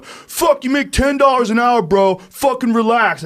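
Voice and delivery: high, loud mock yell at the clerk; imitating the gas station yeller berating the clerk; imitating an angry yell. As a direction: ham up the drunk guy yelling. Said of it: "I heard that guy going".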